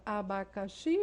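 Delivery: abacaxi with the last syllable XI stretched out; stress on final XI. Shown as a demonstration. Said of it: "Esse X está na última sílaba".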